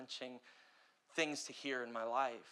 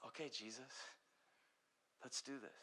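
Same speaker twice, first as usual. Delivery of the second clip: quiet surrender; 11 dB quieter than usual. Retold or imitated. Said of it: "and I thought".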